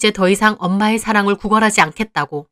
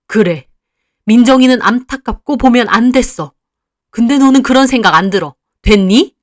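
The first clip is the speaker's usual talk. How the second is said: loud, cutting rejection; angry mother; 3 semitones higher, 6 dB louder than usual. Acted. Mother telling her daughter she dislikes her, loud and cutting. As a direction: tell her flatly you hate her. Mother favours younger sister.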